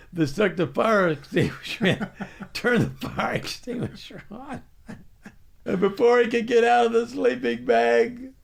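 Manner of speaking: high, loud story told through laughter; can barely get it out for laughing. Real, not acted. Laughing recounting the prank.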